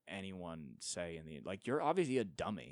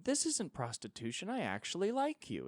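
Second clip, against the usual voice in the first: high, sugary fake sincerity; mock the model's sweet denial; mock sweet protest; 10 semitones higher than usual. Mocking the model's excuse, high and sugary.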